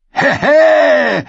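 high, loud mocking cackle; gloating giggle. A narrator's reading. Lord Walder giggles into Rob's face, gloating cackle. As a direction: cackle right in his face. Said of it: "kicherte Lord Walder Rob ins Gesicht".

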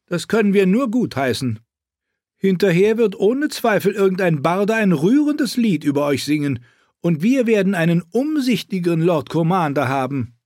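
haughty queen, mocking. Queen voiced in story.

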